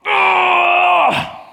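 high strained shout of effort; straining groan. Retold or imitated. Preacher acting out a painful squat, straining shout. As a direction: groan like your legs are giving out.

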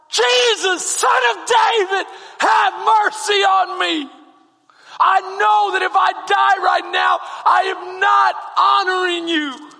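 screamed high and loud, slow and pleading; desperate scream. Retold or imitated. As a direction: cry out for mercy with everything you have. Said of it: "I remember screaming out".

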